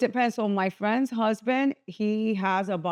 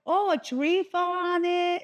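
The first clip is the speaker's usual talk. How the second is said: much higher, slow, airy answer; drawn-out high casual voice; breezy matter-of-fact reply; 9 semitones higher, slower than usual. Retold or imitated. A bystander explaining the tree, high and slow. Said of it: "She's like".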